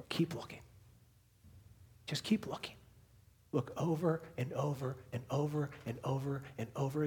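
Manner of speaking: soft, half-whispered exhortation; hushed urging. Real, not acted. Preacher telling the church what the text says, hushed. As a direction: urge them gently, almost under your breath.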